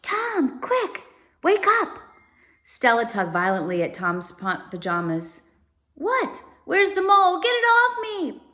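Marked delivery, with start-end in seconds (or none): 0.0-2.0 put-on high, urgent kid voice; urgent high-pitched plea; urgent wake-up call. A narrator's reading. Child waking brother urgently. 6.0-8.4 put-on high, loud panicked kid voice; panicked boy just woken; startled panic. A narrator's reading.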